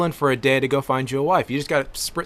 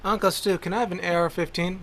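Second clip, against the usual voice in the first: a hint of a higher, softer child asking; mock kid voice; 5 semitones higher, 6 dB quieter than usual. Niece asking her uncle for a rifle, lightly childlike. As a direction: lightly play a little niece begging.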